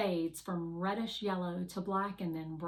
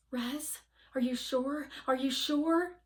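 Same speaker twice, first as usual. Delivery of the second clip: pressing Fly; 6 semitones higher than usual. Acted. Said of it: "Fly asks again".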